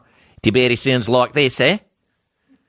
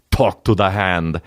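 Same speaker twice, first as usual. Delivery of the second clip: put-on low slow light Austrian accent; Austrian Terminator voice; 5 semitones lower, slower than usual. Terminator to a clerk, slow Austrian accent. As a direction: drag it out like Arnold. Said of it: "he looks at a clerk and he says".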